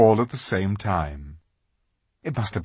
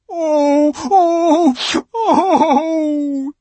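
loud high sobs; sobbing; 9 dB louder, much slower than usual. A narrator's reading. Fawn sobbing to Lucy, loud high wails. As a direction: wail the sobs out. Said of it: "sobbed Mister Tumnus".

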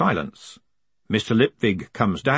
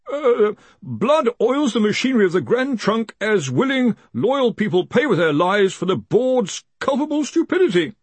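put-on high, halting, anxious young wizard; timid underling reciting a grim message; frightened reader; 10 semitones higher than usual. A narrator's reading. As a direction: read it out nervously in a pitched-up voice.

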